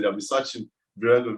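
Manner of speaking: normal talking voice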